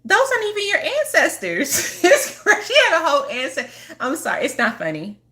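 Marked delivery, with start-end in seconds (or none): 0.0-2.0 high, laughing through the words; laughing disbelief. Retold or imitated. Laughing at a client's fake ancestor altar. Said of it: "and I was like". 2.0-3.9 real laughter breaking up the words; can't get the sentence out for laughing. Real, not acted. Laughing while telling viewers about the altar. Laughing fit mid-story.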